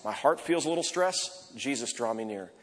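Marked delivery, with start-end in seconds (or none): 1.6-2.5 soft, lower murmured plea; quiet breath prayer. Shown as a demonstration. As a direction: breathe it out in the traffic. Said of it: "I'm walking into the office".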